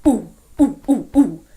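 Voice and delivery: low monkey hooting; big monkeys hooting, lightly done; big monkey call. Acted. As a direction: hoot like a big ape. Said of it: "Big monkeys go".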